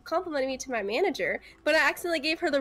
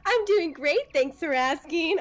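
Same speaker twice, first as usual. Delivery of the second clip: high, drawn-out nice drive-thru voice; fake customer-service cheer; 3 semitones higher, slower than usual. Shown as a demonstration. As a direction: answer like an over-nice order taker. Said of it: "Customer service voice fake is so fake".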